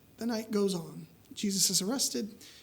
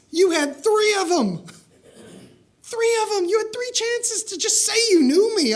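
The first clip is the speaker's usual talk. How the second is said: loud, much higher, near tears in frustration; imagined Jesus rebuking Peter, loud and much higher; indignant scolding; 9 semitones higher, 5 dB louder than usual. Retold or imitated. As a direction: let the hurt spill into a rebuke.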